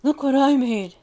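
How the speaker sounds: high, loud, beaming with pride; proud pup shouting with joy; proud excited shout. Acted. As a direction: show off the cake loudly. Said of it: "Chunk beamed with pride".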